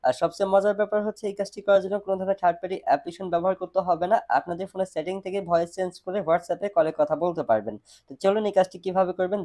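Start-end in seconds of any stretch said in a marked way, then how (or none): none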